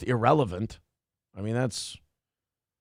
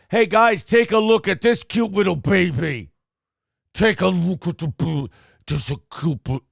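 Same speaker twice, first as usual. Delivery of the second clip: talking to a dog; 3 semitones higher, 3 dB louder than usual. Shown as a demonstration. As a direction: coo at a good boy. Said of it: "wait, that's dog voice".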